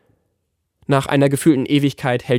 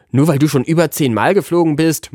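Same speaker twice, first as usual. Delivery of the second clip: higher and louder, a whiny sulk; a child grumbling at his know-it-all friend; sulky grumble; 4 semitones higher, 5 dB louder than usual. A narrator's reading. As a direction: grumble it like a pouting boy. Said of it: "mault Tommy".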